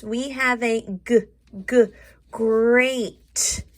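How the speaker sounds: g, g, then great, built from its first sound; teacher sounding out great. Shown as a demonstration. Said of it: "I want to really stretch that out".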